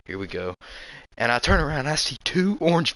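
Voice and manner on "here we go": much softer and lower, under the breath; quiet hunter's murmur; hushed to himself. Retold or imitated. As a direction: keep it low so the deer won't hear. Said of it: "I'm like".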